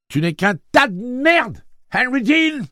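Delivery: high, loud, slow furious cry. A narrator's reading. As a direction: hurl it at him with all her rage. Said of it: "s'écria la jeune fille".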